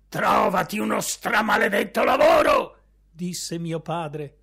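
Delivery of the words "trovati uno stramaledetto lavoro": fast, loud furious yell, much higher; angry shout. Acted. Father yelling at his son about work, loud fast shout. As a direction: bark it at him, hot-tempered and quick.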